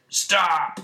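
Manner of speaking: sudden cartoon traffic-cop yell; shouting. Robot Man stops the traffic, shouting. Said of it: "stops the traffic by shouting at it".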